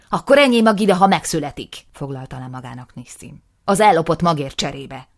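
loud, high, escalating tirade; furious rant. Acted. Nisim raging at his brother, loud and high. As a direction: build to a furious yell.

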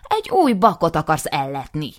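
loud, furious outburst; angry accusation. Acted. Nisim accusing his brother, loud and furious. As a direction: spit out the accusation.

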